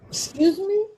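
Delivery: high rising excuse me; stunned excuse me; incredulous. Real, not acted. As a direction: sound stunned, pitch way up. Repeats incredulous question.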